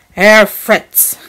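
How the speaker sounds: loud, clipped warning to his son; two words that end the argument; stern rebuke. A narrator's reading. Father rebukes his son with a loud warning. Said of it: "these two words slipped out of him".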